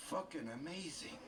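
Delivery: ordinary talk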